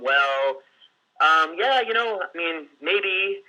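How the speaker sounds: halting, unsure client with pauses and fillers; broken hesitant answer. Retold or imitated. Sales coach imitating a hesitant client's broken answer. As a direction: hold back, trail off, drop into maybe. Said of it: "It's gonna just be very broken like that".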